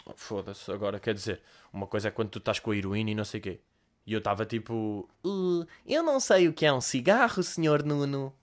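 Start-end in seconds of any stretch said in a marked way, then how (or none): 0.1-3.6 low, soft, fast rough-guy talk; casual street talk about drugs; lightly voiced low mock tough guy. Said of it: "E o gajo a dizer". 5.3-8.3 slow, timid goody-goody voice; sheltered kid replying politely; a hint of a prim naive boy; naive posh boy. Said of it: "Completamente de betinho virgem".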